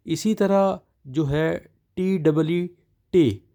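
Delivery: usual speaking voice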